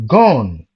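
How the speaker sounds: "gone" said loud and falling; stress on gone. Shown as a demonstration. Teacher showing the strong beat on "gone". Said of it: "you will see".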